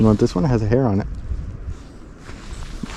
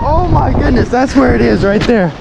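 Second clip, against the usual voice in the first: excited shouting; 11 semitones higher, slower than usual. Real, not acted. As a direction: yell out in awe. Still shouting over the wind.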